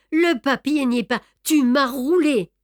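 plus haut et plus fort, colère; greffier furieux. Acted. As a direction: accuser avec rage. Greffier furieux d'être roulé. (Brédoux accuse Beautrelet de l'avoir roulé, furieux)

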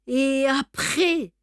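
étranglé, lent et plus haut, la gorge serrée; voix étranglée. A narrator's reading. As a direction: arracher les mots d'une gorge nouée. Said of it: "dit-il d'une voix étranglée". (Beautrelet, menacé, demande d'une voix étranglée)

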